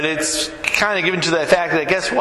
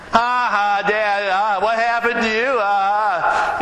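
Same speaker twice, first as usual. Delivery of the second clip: higher voice with fake ha-ha laughter; mocking laughing daughter; 5 semitones higher, slower than usual. Daughter laughing at her dad's mishap, mock laughing taunt. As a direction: imitate a kid teasing with put-on laughs. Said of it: "she starts laughing".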